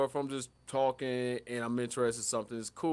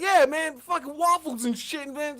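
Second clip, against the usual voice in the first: much higher, louder offhand dad on the phone; higher, louder lazy dad; 11 semitones higher, 3 dB louder, slower than usual. Retold or imitated. Dad's phone call voiced.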